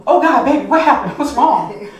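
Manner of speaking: loud, high, rushed alarm; panicking husband. Retold or imitated. Husband panicking at his crying wife, loud and alarmed. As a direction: blurt it out like a frightened husband. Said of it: "my poor husband is panicking".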